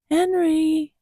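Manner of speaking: high, drawn-out name call; coaxing call. Real, not acted. Seeing if Henry will open his eyes, coaxing call.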